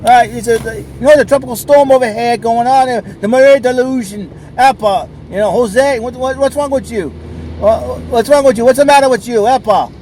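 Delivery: high, loud hammed-up Portuguese accent; imitating a Portuguese cop scolding surfers, loud Portuguese accent; mock Portuguese cop. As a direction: play a flustered Portuguese cop with a heavy Portuguese accent. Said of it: "some crazy Portuguese cop or something goes".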